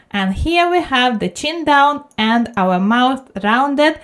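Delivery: ordinary talk, nothing marked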